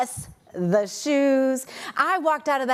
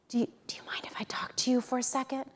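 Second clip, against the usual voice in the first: secretive whisper, no voice; co-worker whispering to her by the elevator; 10 dB quieter, much faster than usual. Retold or imitated. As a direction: hiss the name from the shadows. Colleague whispering a secret request.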